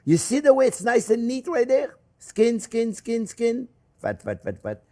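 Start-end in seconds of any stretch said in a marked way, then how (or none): 2.2-3.7 sing-song skin. Acted. Sing-song naming the bacon sides. 4.0-4.8 low sing-song fat. Acted. Cook naming the fat side, low sing-song. Low answer to high skin chant.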